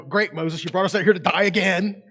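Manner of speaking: mock aggressive grumble. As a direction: throw the complaint at Moses with a sneer. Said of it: "like, aggressively".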